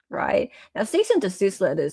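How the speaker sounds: ordinary delivery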